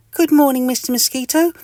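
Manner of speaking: happy, very high squeaky voice; Mister Itch. Acted. Mister Itch greets the mosquito, high and happy. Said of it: "in a happy voice".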